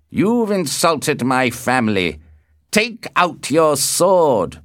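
high, loud demand, slowing down; angry shout. A narrator's reading. Tybalt demands a duel from Romeo, shouting angrily. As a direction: shout the challenge, dragging out the order. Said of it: "shouted Tybalt".

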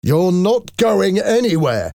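high, slow, forceful forbidding; angry shout. A narrator's reading. Father forbidding his daughter to leave, angrily shouting. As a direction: forbid it, pitch pushed high on every word. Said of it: "Valjean shouted angrily".

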